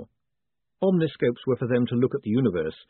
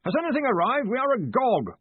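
high, hearty raised voice; blustering, impatient shout; 11 semitones higher than usual. A narrator's reading. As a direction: call out briskly across a great distance. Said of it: "shouted Ridcully".

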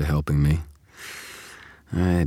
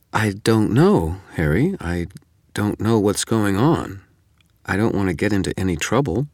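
hesitant; 5 semitones higher, 3 dB louder than usual. A narrator's reading. Susan reluctant to help a murder suspect, hesitant. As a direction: draw it out, unsure and reluctant.

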